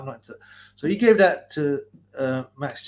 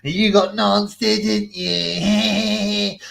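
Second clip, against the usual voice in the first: mocking taunt; 6 semitones higher, 6 dB louder, much slower than usual. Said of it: "mocking Sonny".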